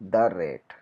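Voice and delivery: slow "the" said as a short "duh", then rate. Shown as a demonstration. Teacher shows "the" said as a short "duh" before a consonant. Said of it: "तब उससे पहले हम द बोलते हैं".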